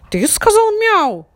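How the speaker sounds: high, loud stern question; stern puppy. A narrator's reading. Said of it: "строго спросил".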